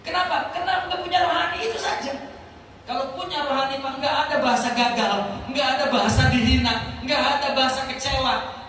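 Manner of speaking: loud, high, driving sermon delivery; full-force preaching. Acted. Preacher rousing the congregation about the spiritual soul, loud and high. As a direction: hammer each nggak ada bahasa.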